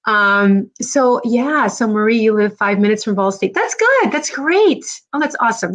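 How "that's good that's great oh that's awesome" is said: high, excited approval; delighted approval. Real, not acted. Host hearing viewer lives near Ball State, delighted. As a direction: react with delight, pitch leaping up.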